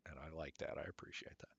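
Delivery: breathy whispered aside; soft whisper. Real, not acted. Streamer whispers his appreciation of YouTube, whispered. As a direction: whisper it to yourself.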